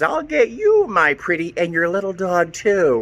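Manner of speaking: high cackling witch threat; Wicked Witch. Retold or imitated. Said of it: "like when the Wicked Witch on The Wizard of Oz says".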